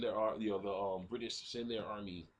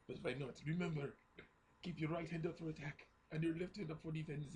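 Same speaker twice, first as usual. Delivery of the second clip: higher, halting deathbed voice; dying soldier; 6 semitones higher, 4 dB quieter than usual. Retold or imitated. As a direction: imitate feeble last words with pauses. Dying soldier gives last advice.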